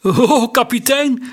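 high, loud, unsteady cry out; unsteady call. A narrator's reading. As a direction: call out with a shaky, startled voice. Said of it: "riep hij met onvaste stem".

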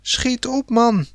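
very high, impatient squeal; shrieked urging. A narrator's reading. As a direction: shriek it high without full volume. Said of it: "gilde Sanne". (hoog, ongeduldig gegild)

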